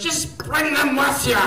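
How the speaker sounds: loud, high come-on; eager woman's voice. Retold or imitated. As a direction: call out like a keen woman. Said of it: "she goes".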